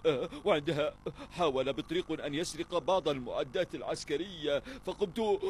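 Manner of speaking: ordinary talk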